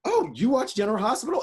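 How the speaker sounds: retold pleased surprise; pleasantly surprised. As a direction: react with polite surprise to a fan. Retold reaction to recognition.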